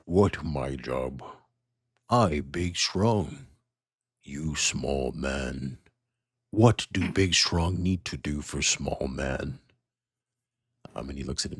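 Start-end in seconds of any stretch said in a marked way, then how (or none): 0.0-9.6 very slow dim brute voice; brutish half-orc. Acted. Gromish asking the small man what his job is. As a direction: talk like a big dumb strongman. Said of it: "and he says".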